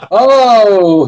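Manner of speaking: put-on lament, stretched out; imitating email writer. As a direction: draw out a pretend cry of dismay. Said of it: "He says".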